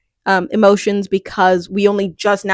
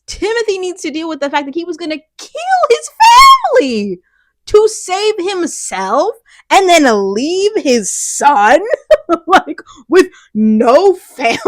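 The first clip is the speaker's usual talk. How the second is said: high, loud outrage breaking into laughter; incredulous outburst; 7 semitones higher, 4 dB louder than usual. Real, not acted. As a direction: build to a shout, then laugh in disbelief.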